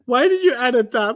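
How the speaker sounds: ordinary talk, nothing marked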